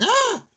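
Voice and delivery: sudden high mock scream of fright; imitated shriek of surprise; startled yelp. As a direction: yelp as if someone popped out of nowhere. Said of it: "I'm like".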